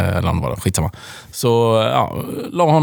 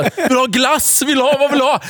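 high-pitched, anxious offer; retold parent pleading with a child; coaxing parent; 12 semitones higher than usual. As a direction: coax it out, pitched up. Parent desperately offering child ice cream.

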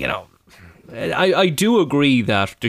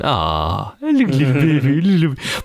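put-on cooing at a baby; 4 semitones higher than usual. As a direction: coo over a buggy like a broody would-be dad. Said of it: "looking at the buggies going".

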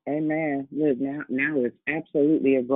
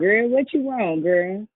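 playful teasing; 6 semitones higher, 4 dB louder, slower than usual. Real, not acted. Teasing a friend clearing throat.

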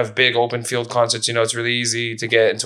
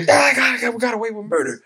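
Seinfeld aside; 9 semitones higher than usual. Retold or imitated. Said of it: "like a Seinfeld episode".

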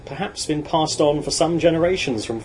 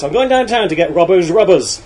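put-on loud, high, broad-accented yell; yelling back; 6 semitones higher, 10 dB louder than usual. A narrator's reading. Lad yelling back to get laughs from the shop, top of his voice. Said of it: "he would yell back at the top of his voice".

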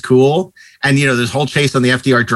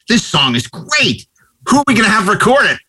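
retold music man; 7 semitones higher than usual. Retold exchange between music men.

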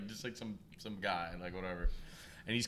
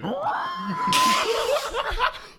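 high piercing burst of laughter; shrieking laugh; 7 dB louder than usual. Real, not acted. Reacting to sloth impression.

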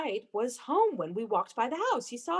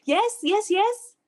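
higher, louder frantic yeses; rising frantic insistence; 6 semitones higher, 6 dB louder, slower than usual. Acted. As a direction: insist wildly.

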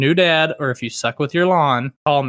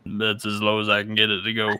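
deepest voice; 4 semitones lower, 3 dB quieter than usual. Shown as a demonstration. Podcast host showing co-host his deep real voice, pushed low. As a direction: drop the voice to the bottom of its range. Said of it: "my real voice is, is more like this".

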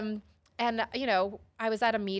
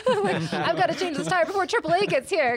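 laughing; 9 semitones higher, 9 dB louder than usual. Retold or imitated. Racing roadside assistance to fix tire.

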